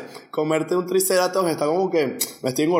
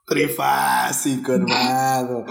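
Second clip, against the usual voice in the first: slow, lower stoner dude; stoned turtle; 4 semitones lower, much slower than usual. Pretend. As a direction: drag the words, totally baked. Stoned sea turtle speaking.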